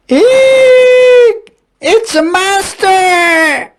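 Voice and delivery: high, loud shriek of fright; frightened cry. A narrator's reading. Dog screaming at the pumpkin-covered dragon, terrified. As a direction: squeal it out in terror. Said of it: "cried the dog".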